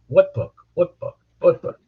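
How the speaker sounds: a mock thick Italian accent, lightly done. Old mobster repeating it to the prosecutor, in a lightly put-on Italian accent. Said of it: "in his thick, thick Italian accent".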